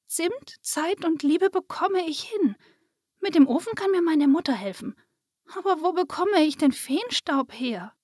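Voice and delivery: put-on high-pitched, wondering child; little girl. A narrator's reading. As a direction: think it through as a young girl. Lisa speaks after drooping her shoulders. (hohe, nachdenkliche Mädchenstimme)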